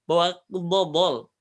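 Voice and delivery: slow dodol with the heavy dha; mock heavy dodol; joking heavy dodol. Shown as a demonstration. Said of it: "Bawa apa".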